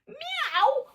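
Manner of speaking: high, soft meow; a soft kitty meow calling the cats. Pretend. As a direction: mew like a little cat. Imitating cats for kids.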